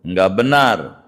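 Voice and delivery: normal talking voice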